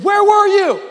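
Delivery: high, loud acted outcry; upset, frustrated. Martha confronting Jesus on arrival, frustrated cry. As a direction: hurl the question as a wailing accusation. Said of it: "Martha is frustrated, and she's saying".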